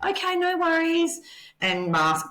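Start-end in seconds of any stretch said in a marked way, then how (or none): 0.0-1.2 high, sing-song fake cheerfulness; masked politeness. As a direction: paper over the hurt with a bright high okay. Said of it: "Kat was very much like".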